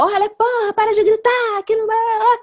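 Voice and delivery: high, loud exasperated outburst; angry shout. Acted. Yelling back at someone who shouted, angry shout. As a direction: yell back at them, fed up.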